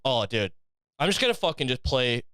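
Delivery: ordinary talk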